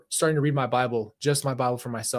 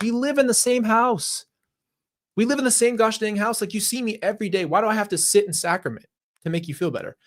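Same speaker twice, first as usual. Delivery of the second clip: retold fed-up outburst, higher-pitched; exasperated protest; 8 semitones higher, 3 dB louder than usual. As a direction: argue back in a higher, incredulous voice. Retelling exasperated argument with mom.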